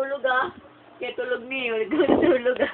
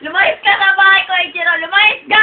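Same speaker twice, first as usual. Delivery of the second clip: loud, raised order to get out; playacted furious eviction; mock angry shout; 4 semitones higher, 7 dB louder than usual. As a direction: throw him out in a pretend rage. Acted quarrel, throwing Ichiro out.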